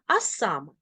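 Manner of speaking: usual speaking voice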